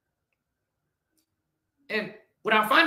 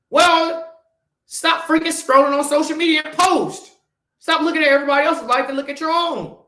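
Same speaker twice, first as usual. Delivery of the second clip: raised, louder tough-love rant; scolding the audience, louder and higher; direct; 5 semitones higher, 4 dB louder than usual. Real, not acted. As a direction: call them out hard. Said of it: "I'm talking very direct right now".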